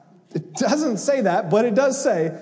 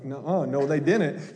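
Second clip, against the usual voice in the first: mock disbelief; 8 semitones lower than usual. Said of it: "shaking their head like".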